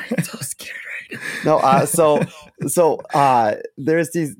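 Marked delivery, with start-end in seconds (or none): 0.1-1.3 scared whisper; mock-fearful whisper. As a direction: whisper it like someone might hear.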